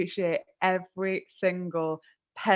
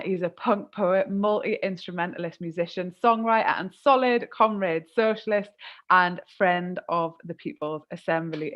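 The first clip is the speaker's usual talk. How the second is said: normal talking voice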